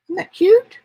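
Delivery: high, delighted squeal; excited. Real, not acted. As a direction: gush over it with glee. Showing off her craft piece.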